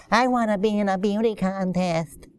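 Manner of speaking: put-on kiddie voice; five-year-old girl. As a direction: imitate a small child asking. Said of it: "Five-year-old didn't call up and said".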